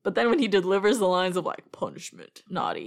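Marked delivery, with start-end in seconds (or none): 1.7-2.9 low, soft, toneless words; emotionless delivery. Retold or imitated. Host imitates the actor's lines, emotionless low murmur. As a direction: drop low and give it no emotion. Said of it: "doesn't even say it with that much emotion".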